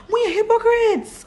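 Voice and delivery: high-pitched accusing outburst; exclaimed accusation. Real, not acted. Woman calling out her online critics as hypocrites, high accusing outburst.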